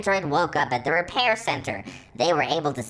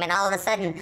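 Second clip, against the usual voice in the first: usual speaking voice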